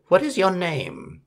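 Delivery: high, sly call from the trees; lightly voiced witch call. A narrator's reading. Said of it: "Suddenly she calls".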